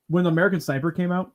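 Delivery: normal talking voice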